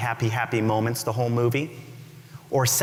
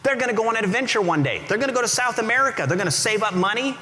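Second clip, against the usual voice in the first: much higher, fast, eager rush of hope; hopeful excitement; 10 semitones higher, faster than usual. Acted. Storyteller lifting the audience back up, excited and high. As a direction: sell the sudden good news, breathless and bright.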